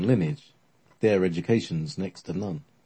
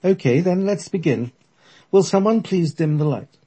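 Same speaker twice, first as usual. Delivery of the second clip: put-on old lady medium; 10 semitones higher, 5 dB louder than usual. The medium's voice continues.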